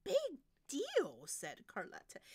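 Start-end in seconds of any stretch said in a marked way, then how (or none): 0.0-1.2 high, loud diva retort; scoffing soprano. Acted. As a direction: shrug it off loudly.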